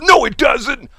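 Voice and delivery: high, loud mock outrage; imitated indignant yell; hyper protest. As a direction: shout back like a worked-up friend. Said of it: "gets all hyper".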